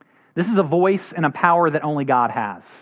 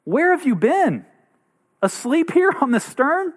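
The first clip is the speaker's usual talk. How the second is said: higher, rising alarm at a sleeping master; panicked disciples; 10 semitones higher than usual. Retold or imitated. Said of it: "They go and say".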